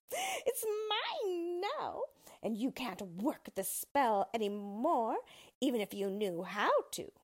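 high, slow, taunting witch voice; gloating witch. Acted. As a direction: drag it out to taunt Dorothy. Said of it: "she said".

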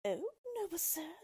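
high-pitched storybook appeal; distressed plea. A narrator's reading. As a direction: beg as the desperate queen. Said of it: "said the queen in great distress".

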